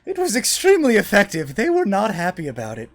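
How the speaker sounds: high, loud character voice; emphatic high-voiced woman; wizard woman. Wizard woman defends her spell.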